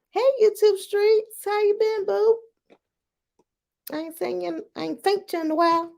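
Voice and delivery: high, sing-song put-on greeting; mock sweet greeting. Host greeting her YouTube audience in a high sing-song voice. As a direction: pitch it way up, sugary and teasing.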